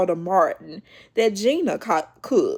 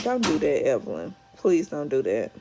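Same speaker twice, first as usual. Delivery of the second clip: softer and lower, begging her to stop; pleading; 5 semitones lower, 5 dB quieter than usual. Retold or imitated. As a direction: drop down and plead with Evelyn. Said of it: "I said".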